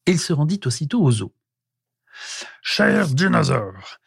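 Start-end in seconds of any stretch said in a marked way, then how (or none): 2.6-4.0 slow caveman proclamation; solemn declaration. A narrator's reading. Prehistoric man addressing the caged dinosaurs, declaring solemnly. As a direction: declare it formally, stretching the words. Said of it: "déclara-t-il".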